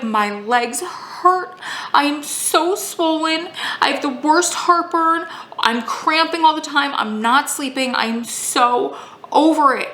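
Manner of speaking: high, drawn-out tearful whine; mock sobbing. Pregnant wife pours out complaints to husband, mock sobbing. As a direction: wail each complaint, imitating your own sobbing. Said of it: "I just started sobbing".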